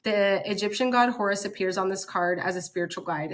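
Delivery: ordinary delivery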